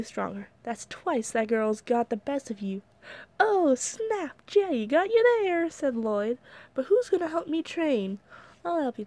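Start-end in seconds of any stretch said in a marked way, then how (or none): 3.4-5.7 high, gleeful teasing; gleeful tease. Acted. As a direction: rub it in, grinning. Lloyd teasing Jay.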